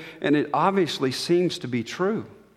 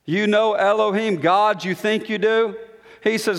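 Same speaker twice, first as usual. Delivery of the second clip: ordinary talk, nothing marked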